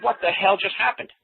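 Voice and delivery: incredulous, voice pitched well up; astonished cop. Retold or imitated. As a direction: imitate a bewildered cop asking what went on. Cop's astonished question in retold story.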